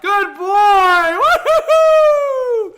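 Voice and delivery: very high, loud laughing squeal of praise; delighted cheer. Real, not acted. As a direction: celebrate the dog barking, overjoyed. Dog finally barks.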